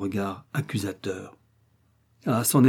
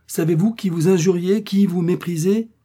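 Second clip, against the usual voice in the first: indignant scolding; 8 semitones higher, 6 dB louder than usual. Acted. Old woman scolding the family.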